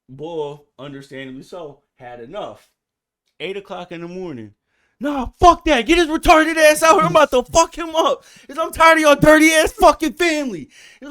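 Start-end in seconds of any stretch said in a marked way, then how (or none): 5.0-10.7 high, loud enraged yelling; hollering at the neighbors across the fence; furious shout. Retold or imitated. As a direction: scream it like you're ready to fight. Angry neighbor quoted cussing out.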